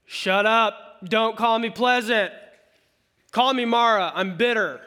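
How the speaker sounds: loud, bitter snap back at the women; half-acted angry retort; mock furious refusal; bitter outburst. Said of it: "And she's like".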